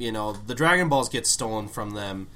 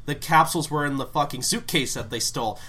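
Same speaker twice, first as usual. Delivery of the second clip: ordinary talk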